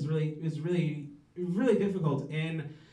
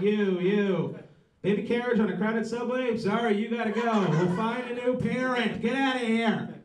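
higher-pitched, brusque pretend ordering; mock dismissal; 5 semitones higher than usual. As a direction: send strangers away like a playful judge. Acts picking strangers to trade.

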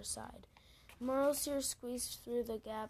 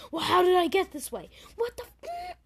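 much higher and louder, spiraling into alarm; rising panic; 7 semitones higher, 12 dB louder than usual. Pretend. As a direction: freak out as it dawns on you. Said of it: "I'd be like".

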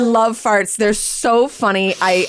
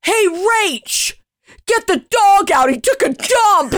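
dad calling up the stairs to his daughter, raised voice; 9 semitones higher, slower than usual. Retold or imitated. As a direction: shout up to the bedroom like a dad. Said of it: "you hear Dad downstairs go".

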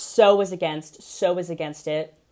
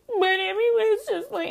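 imitating Dina crying; 14 semitones higher than usual. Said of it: "she'll just be sitting on the couch being like".

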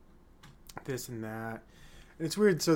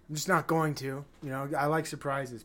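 normal talking voice

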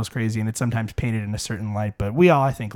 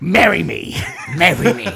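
louder, higher mock marriage proposal; hammed-up proposal; 5 semitones higher, 4 dB louder than usual. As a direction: playfully act out proposing, breaking into laughs. Acts out proposal with the pose.

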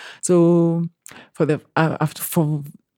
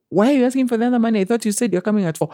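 higher and fast, feigning surprise; mock innocent boss; 7 semitones higher, much faster than usual. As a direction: rattle it off as the dodging employer. Employer voiced in retold exchange.

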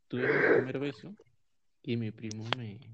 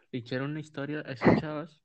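ordinary talk

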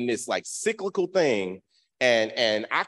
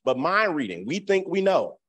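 ordinary talk, nothing marked

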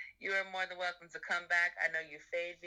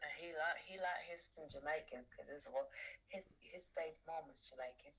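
put-on a playful, rough try at a London English accent; an American hamming up an English accent, laughing at the end; mock English accent; 12 dB quieter than usual. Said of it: "I love when you do my accent".

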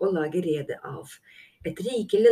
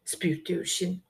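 ordinary delivery, nothing marked

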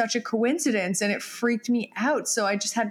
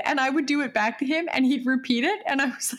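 ordinary delivery

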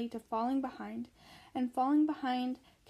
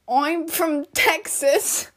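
put-on loud, very drawn-out Texan accent; 3 semitones higher, 9 dB louder, much slower than usual. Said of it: "Texan accent".

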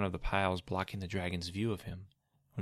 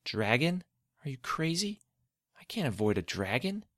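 disbelieving; 6 semitones higher than usual. Acted. Boy panics at dragon news.

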